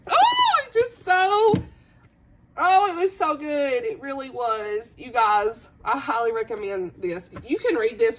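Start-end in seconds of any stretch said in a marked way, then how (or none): 0.1-3.8 high, squealed rave; overwhelmed gushing. Real, not acted. Vlogger raving about a beloved book, overwhelmed. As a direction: gush in a high, bursting voice.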